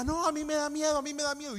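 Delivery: a hint of a nervous young woman backing out; mild imitation of a frightened cousin; scared cousin. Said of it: "Y entonces la prima".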